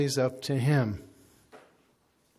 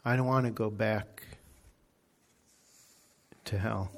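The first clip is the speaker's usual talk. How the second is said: soft fading plea; quiet pleading demon; 3 semitones lower, 6 dB quieter than usual. Retold or imitated. Demon begging not to go back to hell, fading soft. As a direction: let it sink away to nothing. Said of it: "he didn't even say the word heaven".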